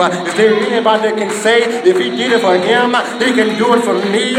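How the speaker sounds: rally the church against the haters; preaching at full force; fired-up preaching. Acted.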